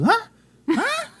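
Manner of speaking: very high, loud mock "huh?" with laughter; oblivious partner. Mocking honey who ignored the subtitled show, high laughing impression. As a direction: imitate a clueless partner, squeaky and laughing. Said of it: "and she goes".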